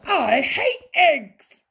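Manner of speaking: high, loud, slow outburst; bratty protest. A narrator's reading. Princess at breakfast refusing eggs, bratty protest. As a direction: stress every word like a child in a tantrum.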